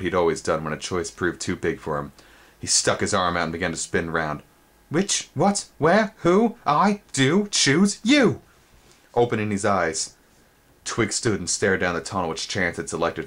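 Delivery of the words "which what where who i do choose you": high sing-song picking rhyme; very high, slowing choosing chant; childish eeny-meeny. Acted. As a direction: spin and chant your choice. Twig spinning to pick a tunnel.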